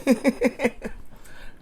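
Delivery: quick airy giggle; light unvoiced laugh; soft breathy laugh. Real, not acted. Laughs after gum remark.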